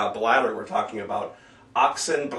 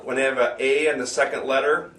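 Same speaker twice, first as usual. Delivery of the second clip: ordinary delivery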